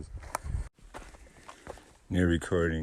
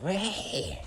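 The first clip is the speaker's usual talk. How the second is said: mosquito voice; 10 semitones higher than usual. Pretend. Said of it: "mosquitoes are like".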